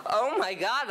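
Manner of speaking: high, loud burst of laughing delight; oh my God, half laughing; laughing exclamation. Acted. Realizes it sounds French, laughs.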